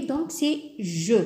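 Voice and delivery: ordinary talk, nothing marked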